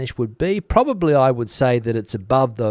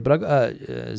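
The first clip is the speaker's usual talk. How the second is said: ordinary delivery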